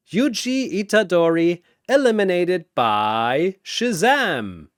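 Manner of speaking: arena announcer. Acted. Announcer declaring Yuji's elimination to the arena, booming. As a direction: boom it out over the crowd.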